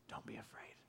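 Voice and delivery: unvoiced, very soft whisper; tender whisper. Jesus comforting frightened disciples, gentle whisper.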